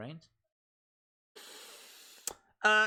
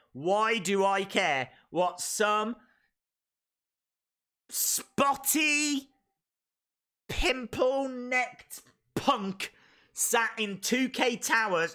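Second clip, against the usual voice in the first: slow rant, higher and shouted on spotty; mock rant; 4 semitones higher, slower than usual. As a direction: spit out the insults slowly. Ranting at the game developer.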